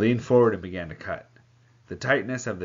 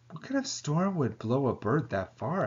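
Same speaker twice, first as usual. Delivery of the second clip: wide-eyed question; 6 semitones higher than usual. Acted. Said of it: "I asked, wide-eyed".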